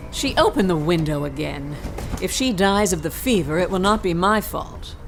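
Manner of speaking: ordinary delivery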